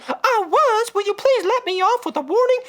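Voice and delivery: high pleading lady voice; speeding woman. Retold or imitated. Speeding driver on the tape begs the officer, high voice. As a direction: plead sweetly to the cop, high.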